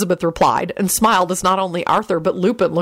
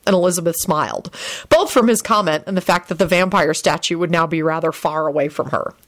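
ordinary delivery

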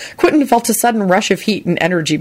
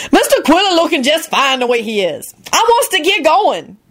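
impatient Lucretia; 10 semitones higher than usual. A narrator's reading. Lucretia urging Quentin to leave, high and impatient. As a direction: push it up high and hurry them along. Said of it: "Lucretia broke in impatiently".

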